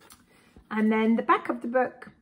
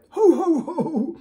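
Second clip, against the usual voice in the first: booming higher ho ho ho with a laugh; dragon laughing; 9 semitones higher, 7 dB louder, slower than usual. Acted. The dragon laughing at a joke from his book, hearty ho ho ho. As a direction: let the dragon laugh out loud. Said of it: "dragon laugh".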